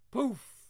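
high loud poof sound; magic poof. Acted. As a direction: pop it like a spell vanishing.

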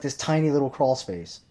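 ordinary delivery

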